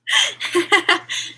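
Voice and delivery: real high-pitched burst of laughter; helpless high laugh; laughing hard. Real, not acted. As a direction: crack up at a friend's expense. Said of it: "why I'm laughing so hard".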